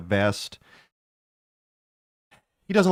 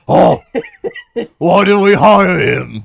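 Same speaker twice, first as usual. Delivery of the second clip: very loud, slow, higher mock lament; booming theatrical exasperation; changed Melvin voice; 8 semitones higher, 15 dB louder, slower than usual. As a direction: bellow it in a hammed-up voice, stretched out. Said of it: "Melvin's just voice changes".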